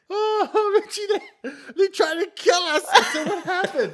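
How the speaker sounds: squealing through laughter, high pitched; high, laughing, breathless retelling. Retold or imitated. Laughing impression of scared crew.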